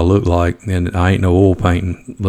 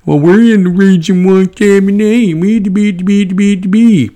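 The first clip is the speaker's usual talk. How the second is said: high, far-off mocking whine of an excuse-maker; mocking whiner; 12 semitones higher, 4 dB louder than usual. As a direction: sneer it in a silly high whiny voice.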